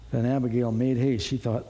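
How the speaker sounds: ordinary delivery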